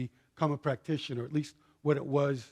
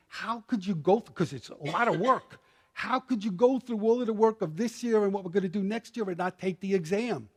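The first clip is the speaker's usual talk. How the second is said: higher-pitched, emphatic disbelief; pitched-up, exasperated "how could you"; incredulous retold protest; 6 semitones higher than usual. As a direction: question your friend's choice, incredulous and pushing. Incredulous retort to friend.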